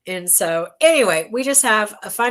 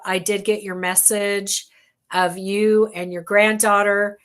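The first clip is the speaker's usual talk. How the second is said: usual speaking voice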